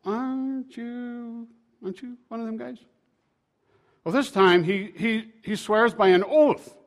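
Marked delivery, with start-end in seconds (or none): 0.0-2.8 put-on second servant girl. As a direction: stretch the first question, high and soft. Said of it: "a second servant girl says".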